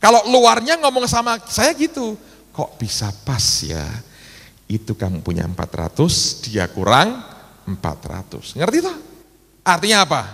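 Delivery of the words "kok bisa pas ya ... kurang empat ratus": slow, low, measured quote; hint of a calm, low divine voice; voice of God. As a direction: say it slowly and low, lightly voicing God. Repeats God's remark to him.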